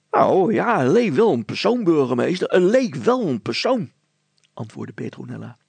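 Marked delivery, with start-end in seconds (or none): 0.1-3.9 put-on high, loud, tumbling girl's voice; a breathless girl blurting out what she saw; higher and louder, stumbling over her words; flustered girl. Petronella answers mayor.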